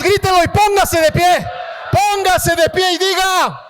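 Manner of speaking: loud, high commands to the crowd; preacher urging the congregation, loud and high; rallying shout. Real, not acted. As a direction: whip the crowd up to stand and shout.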